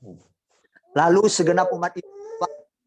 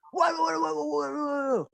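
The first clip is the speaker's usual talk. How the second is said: mock exasperated groan; 13 semitones higher than usual. Preacher voicing selfish believer.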